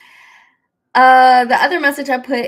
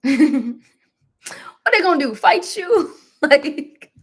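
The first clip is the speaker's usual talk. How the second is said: high, laughing mock challenge; amused scoff through laughter; 6 semitones higher, 5 dB louder, much slower than usual. Real, not acted. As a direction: laugh off the fear as silly. Laughing at fear of viewers.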